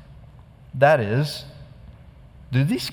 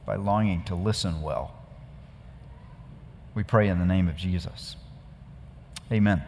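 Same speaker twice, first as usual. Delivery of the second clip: low, soft praying voice; hushed earnest prayer; 4 semitones lower, 4 dB quieter than usual. Acted. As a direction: pray quietly and humbly before the reading. Pastor leads an opening prayer.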